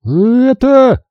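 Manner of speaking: high loud disgusted gasp; high loud revolted matron; squeezed out. A narrator's reading. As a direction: force the word out in disgust. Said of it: "выдавила Убин".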